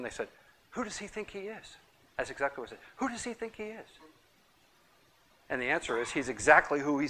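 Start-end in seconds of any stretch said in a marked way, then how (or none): 0.7-1.8 indignant question. Retold or imitated. 3.0-3.9 indignant question. Retold or imitated. Pharisees' question repeated.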